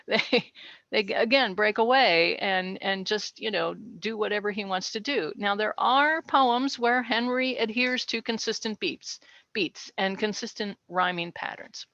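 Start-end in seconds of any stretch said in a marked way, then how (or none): none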